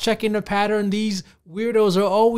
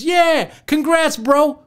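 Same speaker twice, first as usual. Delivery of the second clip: high, louder mock congratulations; mock cheering; 8 semitones higher, 4 dB louder, much slower than usual. Vlogger mocking Reddit commenters, high mock cheer. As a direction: cheer like a fake-supportive commenter.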